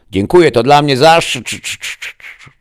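loud, high thanks breaking into stutter; signal cutting out. A narrator's reading. Captain's thanks cut off mid-word, stuttering out. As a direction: stick on the last sound, fading like a lost feed. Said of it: "Dźwięk i obraz z awatara kapitana skokowców został zerwany".

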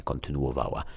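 usual speaking voice

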